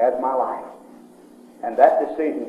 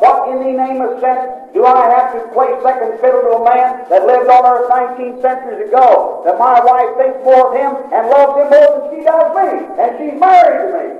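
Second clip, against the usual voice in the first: high, loud indignant rant; jealous outburst; 12 semitones higher, 9 dB louder than usual. Retold or imitated. Husband recalling his jealousy of his wife's faith, loud resentful rant. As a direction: let the jealousy boil over.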